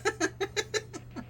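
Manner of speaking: high, squeaky giggle; helpless high-pitched laughter; laughing. Real, not acted. Quiz host cracking up over earworms stuck in their head, laughing.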